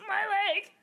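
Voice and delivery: high, loud cartoon cry of pain; mock cartoon yelp. Imitating a SpongeBob background character, high and loud yelp. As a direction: yelp it like a cartoon extra hurting his leg. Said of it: "someone says".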